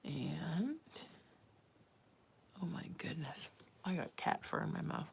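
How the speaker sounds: real whisper, breath only; quiet unvoiced aside; hushed whisper. Real, not acted. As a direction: whisper it as if not to disturb anyone. Finds cat fur mid-live.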